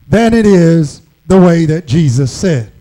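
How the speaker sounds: normal talking voice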